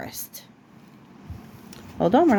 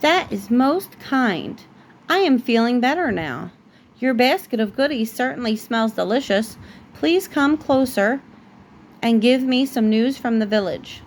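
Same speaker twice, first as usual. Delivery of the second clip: ordinary delivery, nothing marked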